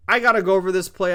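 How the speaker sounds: fast, loud burst of real annoyance; fired-up outburst. Real, not acted. To viewers before breaking down a play, annoyed and loud. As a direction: blurt it out, fired up. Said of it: "I'm actually pissed".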